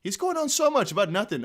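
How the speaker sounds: normal talking voice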